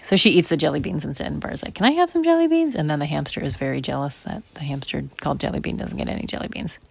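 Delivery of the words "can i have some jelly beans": put-on high-pitched little kid asking; pleading child. Brother asking sister for candy, pleading.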